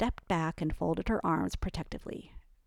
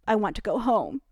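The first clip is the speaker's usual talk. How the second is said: firm, louder demand to go home; 4 semitones higher, 7 dB louder than usual. A narrator's reading. Drunk friend insisting to Ellie she wants home, firm. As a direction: insist on leaving, pushing the words out.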